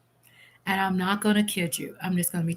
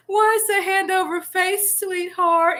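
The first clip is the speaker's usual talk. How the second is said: much higher, coaxing sweetheart; reading a friend's teasing comment high and sweet; teasing chat voice; 10 semitones higher, 3 dB louder than usual. Retold or imitated. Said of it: "Eastside goes".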